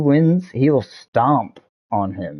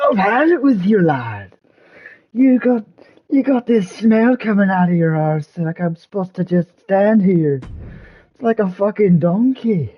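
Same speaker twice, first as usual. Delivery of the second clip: Scottish Drew; 9 semitones higher than usual. Retold or imitated. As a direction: rant at Seth in a put-on Scottish accent. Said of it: "he's just like".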